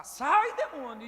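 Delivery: loud commanding cry to the demon; fierce exorcist shout; shouted rebuke. Retold or imitated. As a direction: yell it out like casting a demon out. Preacher retells casting out demon.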